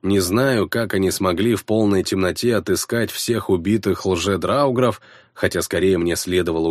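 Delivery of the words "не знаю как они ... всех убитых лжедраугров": usual speaking voice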